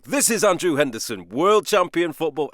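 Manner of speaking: bright, bold announcer read, lightly done; punchy narration. Retold or imitated. Describing his lively episode one narration, a punchy read. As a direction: sell it like a confident sports trailer. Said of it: "it's more like".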